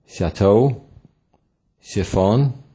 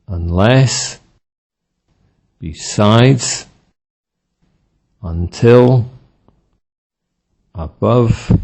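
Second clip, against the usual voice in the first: weak start, strong end: perHAPS, inDEED, unLESS, beLOW, beFORE; word list, each one punched on its second syllable; second-syllable stress; slower than usual. Shown as a demonstration. Teacher drilling adverbs and prepositions with second-syllable stress. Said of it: "with stress on the second syllable".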